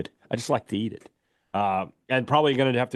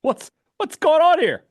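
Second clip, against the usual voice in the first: pitched-up mock shock at a weird remark; alarmed; 5 dB louder than usual. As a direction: squeal it in fake alarm. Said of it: "I was like".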